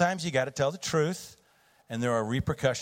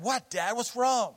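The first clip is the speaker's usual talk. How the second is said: puzzled son; 11 semitones higher than usual. Retold or imitated.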